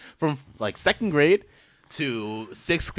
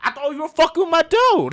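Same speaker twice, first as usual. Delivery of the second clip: loud; 6 dB louder than usual. Retold or imitated. Explaining loudly.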